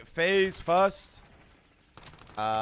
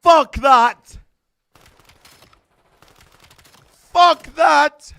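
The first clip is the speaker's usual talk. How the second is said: furious yelled curse, much higher and louder; screaming; 12 semitones higher, 16 dB louder, much slower than usual. Real, not acted. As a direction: scream it at the screen. Screaming at the game.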